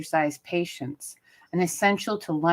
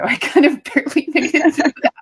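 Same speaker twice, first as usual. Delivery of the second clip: higher and louder, laughing through it; breathless laughing apology; 10 semitones higher, 9 dB louder, faster than usual. Real, not acted. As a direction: laugh it off while still winded. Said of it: "I'm a little out of breath".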